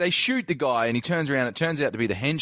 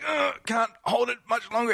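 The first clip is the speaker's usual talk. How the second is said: high strained grunting line; mock straining cop; 11 semitones higher than usual. Shot cop holding up hero, high strained voice. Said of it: "he's like".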